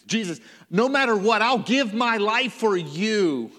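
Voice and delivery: Peter boasting he'll die for Jesus; proud vow. Acted. Proud Peter vowing loyalty.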